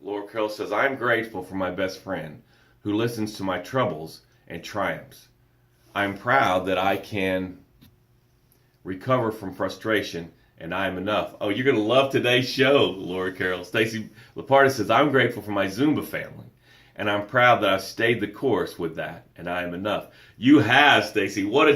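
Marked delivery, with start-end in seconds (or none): 0.7-11.4 softer, lower comment reading. Acted. Reads viewer comment aloud. 14.9-20.1 softer, lower comment reading. Acted. Reads viewer comment aloud.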